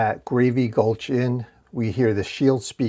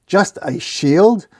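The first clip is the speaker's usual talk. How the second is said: slow, higher, indignant rising question; defensive disbelief; 6 semitones higher, 4 dB louder, slower than usual. A narrator's reading. Shield repeating the dork's slight, defensive and incredulous. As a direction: draw out shield in offended disbelief. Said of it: "Don't get defensive".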